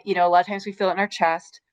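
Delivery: usual speaking voice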